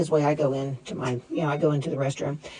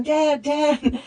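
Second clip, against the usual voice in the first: higher mock call for dad; 8 semitones higher than usual. Daughter calling out to her dad in the car wash, lightly voiced. And I'm yelling.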